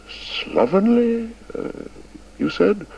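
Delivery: put-on high, slow, baffled old man; bewildered. A narrator's reading. Chips echoing the headmaster's insult, bewildered and slow. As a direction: repeat the word in disbelief. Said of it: "in sheer bewilderment".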